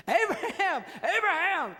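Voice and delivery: high loud call from heaven, Abraham, Abraham; the angel shouts down from heaven to Abraham, calling loud and high. Acted. Angel calling to Abraham from heaven, loud and high.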